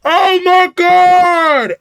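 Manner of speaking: high, slow, drawn-out holler; stretched-out high cry of disbelief; scandalized outcry. Real, not acted. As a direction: wail it high in gleeful shock. Howling at the news reveal.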